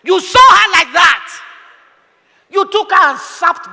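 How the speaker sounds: slow loud accusing shout; shouting preacher. Real, not acted. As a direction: thunder it at the men.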